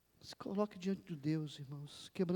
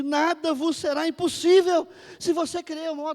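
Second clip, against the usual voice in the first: high, very loud scripture declaration; booming proclamation; full-force preaching; 10 semitones higher, 16 dB louder than usual. Real, not acted. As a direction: hurl the verse out with conviction. Declaims a verse.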